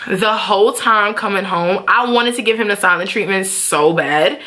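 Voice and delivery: ordinary talk, nothing marked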